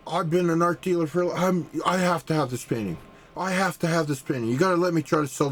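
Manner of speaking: high, rushing, half-mocked pleading; imitating an over-eager art buyer; eager dealer. Dealer begging for the painting.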